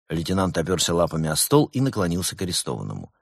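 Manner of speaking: ordinary delivery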